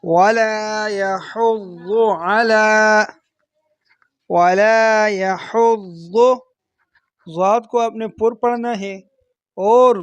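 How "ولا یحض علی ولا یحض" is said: slow drawn-out tajweed; Quran recitation. Shown as a demonstration. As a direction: recite slowly and evenly.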